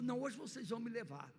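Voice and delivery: tortoise Clotilde insisting the animals take her along; insistent tortoise. Acted. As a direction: demand it, planting her feet. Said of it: "a Clotilde disse".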